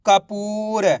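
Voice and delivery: Kapoor run together, long middle पू; blended word. Shown as a demonstration. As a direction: say Kapoor joined up as one word. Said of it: "तो मिलके बन जाएगा". (कपूर को मिलाकर एक शब्द में)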